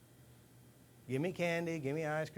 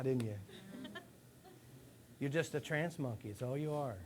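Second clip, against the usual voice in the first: ordinary talk, nothing marked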